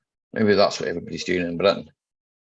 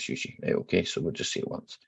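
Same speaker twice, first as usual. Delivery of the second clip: whispered aside; 3 semitones lower, 8 dB quieter than usual. Real, not acted. Quiet aside after mispronouncing.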